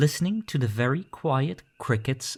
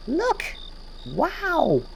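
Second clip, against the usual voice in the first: much higher, breathless with wonder; amazed child. Acted. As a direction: gasp it out in delight. Someone sees car headlights.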